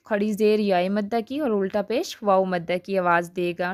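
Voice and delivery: usual speaking voice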